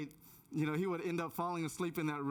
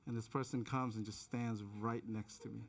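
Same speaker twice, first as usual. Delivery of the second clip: ordinary talk, nothing marked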